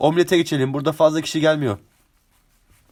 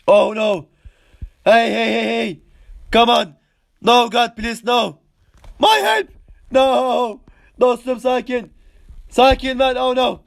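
panicked cries; 5 semitones higher, slower than usual. Real, not acted. Panicking as he is attacked.

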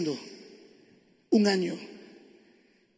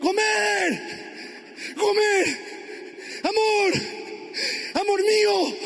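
high, drawn-out searching calls; shouting; 9 semitones higher, slower than usual. Acted. Husband searching all day for his wife, shouting. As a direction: shout the name out into the distance. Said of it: "la voz del orador gritando".

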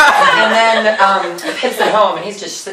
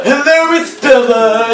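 exaggerated greeting; 2 semitones higher than usual. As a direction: overdo the hello in a raised voice. Exaggerated repeat of the line.

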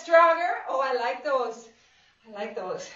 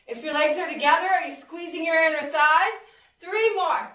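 ordinary talk

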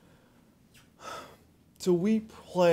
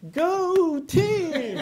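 bright, louder, laughing go team cheer; 11 semitones higher, 6 dB louder than usual. Shown as a demonstration. Dad at his daughter's game, cheering in laughing mock. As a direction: cheer like a sideline fan with pom-poms. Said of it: "all I'm gonna do is go like this".

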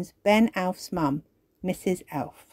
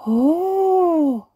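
high, louder delighted oh; excited cry; 10 semitones higher, 6 dB louder than usual. Acted. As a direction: gasp with delight. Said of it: "cry the fairies, clapping their hands excitedly".

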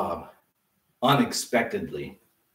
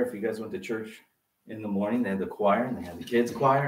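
ordinary talk, nothing marked

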